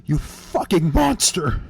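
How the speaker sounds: loud, slow furious yell; angry yelling. Acted. Judy accusing Carl of killing her son, furious yell. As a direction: hurl it at him in rage. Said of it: "She yelled all this".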